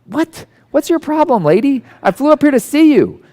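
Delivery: what, lady, are you kidding me; indignant disbelief. Retold or imitated. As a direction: play up the shocked comeback. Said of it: "And I'm like".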